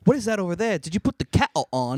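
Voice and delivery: mock-British movie woman; put-on British accent. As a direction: parody a slipping British accent. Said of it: "supposed to be a British broad".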